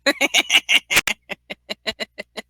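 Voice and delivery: real laugh trailing off in short bursts; staccato laugh. Real, not acted. Co-host amused by colorblind remark, laughing. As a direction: laugh it out, slowly petering away.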